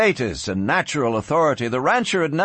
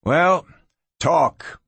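ordinary delivery

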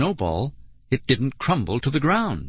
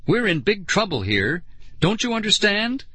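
high, exasperated plea; urgent, upset protest; crying out; 9 semitones higher than usual. A narrator's reading. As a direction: plead with her, pitch climbing. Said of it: "I cried".

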